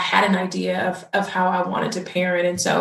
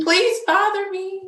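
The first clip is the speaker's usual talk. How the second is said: much higher, begging come over; pleading invitation; 13 semitones higher than usual. Retold or imitated. New mom begging her friend to visit, high pleading. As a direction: plead with your friend, half joking. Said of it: "I was like".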